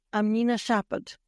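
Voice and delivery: ordinary talk, nothing marked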